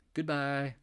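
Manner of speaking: playful farewell. Pretend. Waving off financials.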